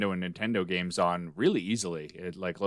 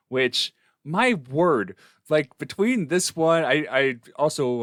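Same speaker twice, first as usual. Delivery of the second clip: slow, higher and louder in disbelief; exasperated; 6 semitones higher, 6 dB louder, slower than usual. Real, not acted. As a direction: drag out the exasperation. Exasperated about hard games.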